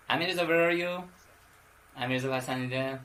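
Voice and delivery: usual speaking voice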